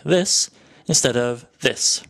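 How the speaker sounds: slow, first "this" stressed then second "this" stressed; trochee versus iamb. Shown as a demonstration. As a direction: contrast the two stress patterns slowly. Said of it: "start an iamb with a hard syllable".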